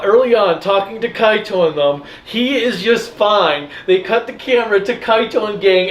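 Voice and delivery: normal talking voice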